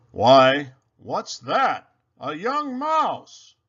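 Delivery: loud surprised exclamation, slowing on the end; surprised Santa. Acted. Santa finds a mouse in his sack, loud and surprised. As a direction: gasp it out, finding a stowaway.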